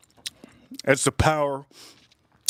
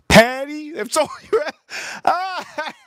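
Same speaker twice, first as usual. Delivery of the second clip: laughing outburst; 11 semitones higher than usual. Real, not acted. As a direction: lose it mid-word in disbelief. Cracking up at the order.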